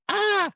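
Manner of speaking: sudden high squeak of fright; scared yelp. Retold or imitated. Wife startled by a stranger, squeaks in fright. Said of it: "she's like".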